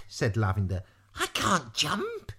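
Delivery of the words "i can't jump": high, drawn-out protest; frightened protest. A narrator's reading. Lavender refusing to jump, high fearful protest. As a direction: balk in a high, slow voice.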